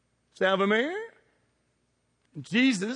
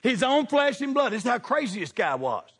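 normal talking voice

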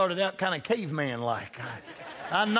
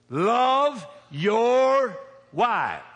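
drawn-out, loud and higher preaching call; very slow, loud pulpit cry; preaching shout; 5 semitones higher, 5 dB louder, much slower than usual. Real, not acted. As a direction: stretch it out and proclaim it to the whole room. Preacher proclaiming scripture.

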